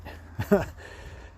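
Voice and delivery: breathy high chuckle; little laugh to herself; soft laugh. Real, not acted.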